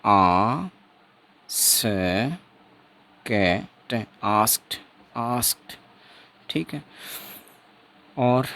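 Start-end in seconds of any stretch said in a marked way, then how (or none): 0.0-4.0 आ, स, क, ट each held apart; sound by sound. Shown as a demonstration. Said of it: "देखो कैसे बोलेंगे इसको". 4.2-5.7 आस्क्ड blended into one syllable with final t; correct t ending. Shown as a demonstration. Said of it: "देखो कैसे बोलेंगे इसको".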